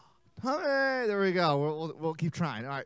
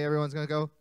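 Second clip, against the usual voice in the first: ordinary delivery, nothing marked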